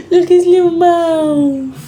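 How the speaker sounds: giggly, high doting laugh. Real, not acted. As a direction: laugh through the coo.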